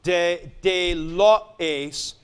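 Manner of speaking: de lo ace drawn out slowly; careful slow reading of de lo ace; slow spelled-out form. Shown as a demonstration. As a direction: read the form slowly for the class. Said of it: "the first form".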